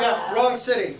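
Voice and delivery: ordinary talk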